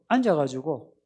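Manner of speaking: ordinary talk, nothing marked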